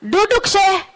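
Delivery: a sharp public rebuke; scolding rebuke. Retold or imitated. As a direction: tell him off for his bad manners. Rebuking sheikh voiced in story.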